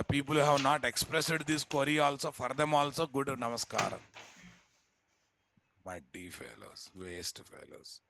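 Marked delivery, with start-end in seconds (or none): 5.8-8.0 low, slow, under the breath; muttered scolding. Real, not acted. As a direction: grumble it half to yourself. Scolding absent students under breath.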